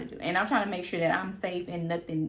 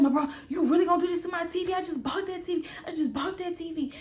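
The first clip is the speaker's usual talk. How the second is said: higher, fast, pleading over the TV; boyfriend's high whiny protest; 8 semitones higher, faster than usual. Retold or imitated. Boyfriend protesting to girlfriend about his TV, high whine. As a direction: complain that she wrecked his new TV. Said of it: "he said".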